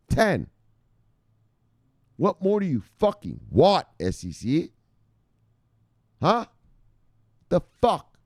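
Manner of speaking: high, incredulous angry demand; outraged. Real, not acted. Outraged rant at the SEC.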